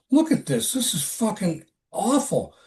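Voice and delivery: high, disgusted disbelief; appalled. Retold or imitated. Coworker shows the photos, high and appalled. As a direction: show him the horror. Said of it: "he says".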